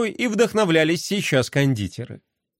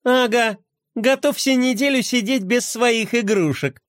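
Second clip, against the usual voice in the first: scornful higher retort; snorting scoff; 7 semitones higher than usual. A narrator's reading. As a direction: scoff at him. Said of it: "фыркнула Глория".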